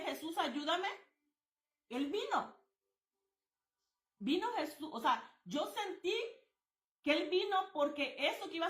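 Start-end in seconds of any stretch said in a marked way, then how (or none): none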